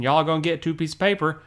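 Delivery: very quick, slurred "Y'all gonna get two pieces of paper"; casual Southern pronunciation. Shown as a demonstration. As if talking to a cousin, casual Southern pronunciation. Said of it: "that pronunciation".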